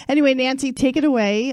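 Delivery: loud, high, excited hand-off; excited announcement. Real, not acted. Host cheerfully hands the floor to Nancy, loud excited call. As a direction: call out big and bright to hand over the show. Hosts hands over the show.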